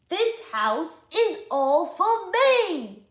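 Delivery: put-on high, slow, loud bossy boy; possessive George. George claiming the house from Lindy, loud and slow. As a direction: stretch each word out, claiming it all.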